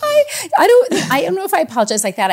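laughing. Real, not acted. Laughing hard after the host's joke.

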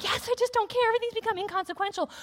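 breezy carefree. Retold or imitated. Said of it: "I'm all of a sudden like".

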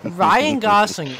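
loud, high, stretched-out name; big drawn-out mock news intro; announcer shout. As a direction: launch the story like a hype announcer. Launching the announced news segment.

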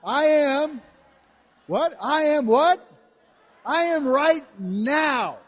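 loud, high call-and-response; preaching at full force. Real, not acted. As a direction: drive the congregation up.

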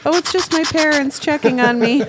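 high, loud pretend kid voice; imitated child. Pretend daughter explaining helicopter parents, high and loud. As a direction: call it out high over rotor noise.

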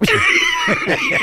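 high-pitched laughing fit; burst of laughter. Real, not acted. Laughs at own cop story.

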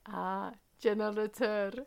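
slow put-on Turkish accent. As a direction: Turkish accent, dad deadpan. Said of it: "the way he said it was in a Turkish accent".

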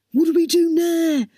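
usual speaking voice

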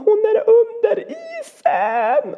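slow, high wail imitating a frantic boy; acted child in despair; panicked child's cry. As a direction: stretch it out in a high panicked scream. Son's panicked phone call.

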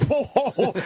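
high mock guffaw as Barnes; imitated laugh. Retelling Barnes's reaction to the poker joke, imitated laughing. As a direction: imitate a man cracking up. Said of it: "he actually finds that so funny".

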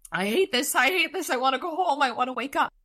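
high, whining despair; acted high-pitched distress; distressed Butters. A narrator's reading. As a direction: fall apart in a high, pleading voice. Voicing Butters falling apart.

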